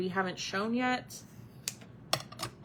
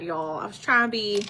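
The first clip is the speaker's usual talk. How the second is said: ordinary talk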